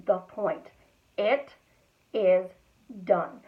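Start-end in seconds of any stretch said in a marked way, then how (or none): 1.2-3.4 "It is done" with a pause after every word; one emphatic word at a time, "It. Is. Done."; spread out deliberately. Shown as a demonstration. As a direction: land each word of "It is done" separately. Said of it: "spread it out deliberately to emphasize".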